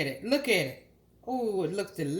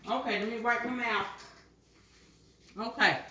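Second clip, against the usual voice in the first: usual speaking voice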